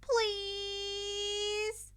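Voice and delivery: put-on high, loud begging girl voice; pleading. Mina begging Izuku for first group, high and pleading. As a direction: wheedle with puppy eyes. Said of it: "turning puppy eyes".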